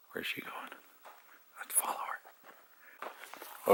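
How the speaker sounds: unvoiced, slow whisper. Real, not acted. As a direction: whisper so she won't hear. Whispering while following.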